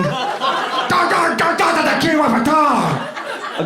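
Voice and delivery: high garbled wail; hammed-up hysterical alarm, stretched at the end; mock panicked screaming. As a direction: scream it in nonsense syllables. Acts out escalating panic at restaurant.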